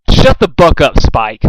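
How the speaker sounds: loud, sharp shut-up; snapping shout. A narrator's reading. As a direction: bark it at the dragon. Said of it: "both mayors said simultaneously".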